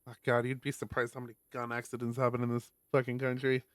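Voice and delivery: ordinary talk, nothing marked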